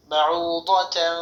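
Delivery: slow বাউদাতান with a nasal hum on the nun; slow, nasalised demo; nasal ghunna. Shown as a demonstration. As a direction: hum the tanween through the nose. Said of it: "ইকফা গুণা".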